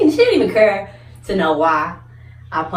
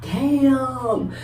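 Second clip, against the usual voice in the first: higher, hyped-up praise; mock impressed classmates; 5 semitones higher, slower than usual. Classmates praising her punch online, lightly hyped. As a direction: hype her up. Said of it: "making posts on Facebook talking about".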